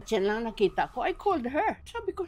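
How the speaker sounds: ordinary delivery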